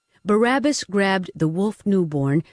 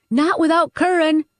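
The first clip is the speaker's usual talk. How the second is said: high, firm protest; defiant refusal; 10 semitones higher, 4 dB louder than usual. Acted. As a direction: refuse to leave him behind.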